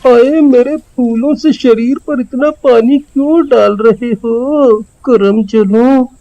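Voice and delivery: a hint of a coy, fussy woman's voice; possessed, coy. Possessed Kishan complaining to the villagers about the water, lightly coy. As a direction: lightly play the witch pouting through him. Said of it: "इस पर चुड़ैल का साया चढ़ गया".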